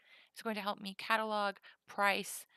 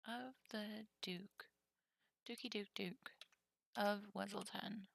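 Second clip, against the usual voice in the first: whispered; 9 dB quieter than usual. Real, not acted. Streamer sorting cards, whispering to self. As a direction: mutter the card name to yourself. Muttering card name.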